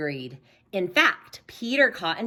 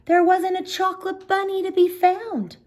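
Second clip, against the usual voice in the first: dismayed; 4 dB louder than usual. A narrator's reading. Narrator's dismay at missing sweets.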